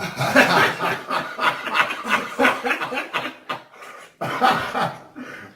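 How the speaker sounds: bursting out laughing; big hearty laugh; loud laughter. Real, not acted.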